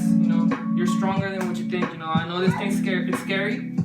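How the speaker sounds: plain reading voice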